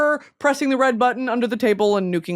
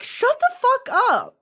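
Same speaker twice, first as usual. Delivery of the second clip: mock snap; 14 semitones higher, slower than usual. Mock snapping at imagined asker.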